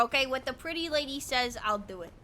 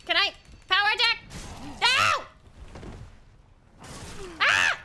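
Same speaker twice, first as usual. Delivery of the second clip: panicked yelling; 6 semitones higher than usual. Real, not acted. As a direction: yelp in frantic exasperation. Panicked play during a boss fight.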